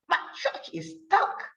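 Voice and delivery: high breathy snapped line; snapped whisper. Acted. Angry duck snapping at the sheep, hushed. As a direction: snap it in a hushed voice.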